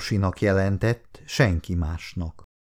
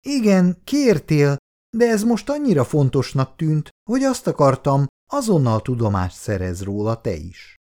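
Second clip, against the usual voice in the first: much higher voiced wife, eager; higher young wife explaining to her husband; young woman voice; 6 semitones higher than usual. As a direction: lift the voice into the young wife, defending herself. Bea's voice in dialogue.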